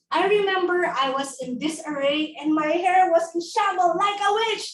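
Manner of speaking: ordinary talk, nothing marked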